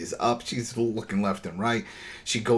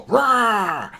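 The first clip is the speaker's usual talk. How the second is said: loud, higher mock zombie roar; zombie growl; 11 semitones higher, 6 dB louder than usual. Host acting out the walker jump scare, loud mock roar. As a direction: jump out at them like a haunted house. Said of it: "you hear go".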